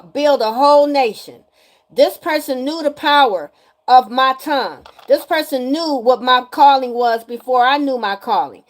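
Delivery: loud, emphatic proclamation; forceful declaration. Real, not acted. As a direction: proclaim your power loudly. Declaring her power as speaker.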